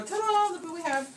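high, bright showman's reveal; showy ta-da. Pretend. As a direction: unveil it like a cooking-show host. Presenting the finished piece on show.

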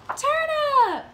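high, drawn-out reveal; singsong page-turn payoff; food reveal. A narrator's reading. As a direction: announce it like a surprise.

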